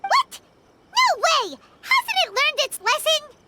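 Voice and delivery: shocked. Real, not acted. Companion hearing Kazuha will be controlled, high shocked outburst. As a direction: blurt it out in disbelief. Companion hears startling news.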